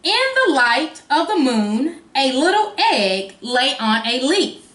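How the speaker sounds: slow, expressive storybook reading; reading to a toddler. Acted. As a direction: read it like a picture book for little ones. Reads the picture book aloud.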